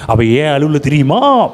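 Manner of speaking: gossip whisper-tone. Retold or imitated. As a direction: lean in like a gossiping aunt, slowly. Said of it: "வீட்டுல சொல்லுவாங்க". (மெதுவான கிசுகிசு தொனி)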